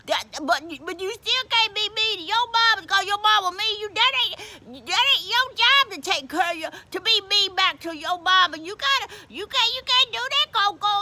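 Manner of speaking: high, stammering protest; insistent scolding. Acted. Deacon correcting Coco about her mama, scolding. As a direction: plead and admonish, tripping over the words.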